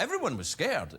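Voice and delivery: usual speaking voice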